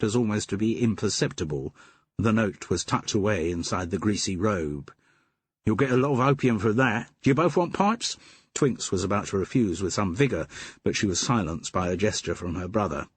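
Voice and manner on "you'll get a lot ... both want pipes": a grudging Cockney voice, a touch higher; the den keeper dropping the act for his own Cockney; Cockney twang. Acted. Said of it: "in a voice with a Cockney twang".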